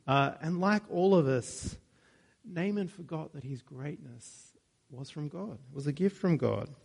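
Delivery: ordinary talk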